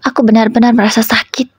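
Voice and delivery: ordinary delivery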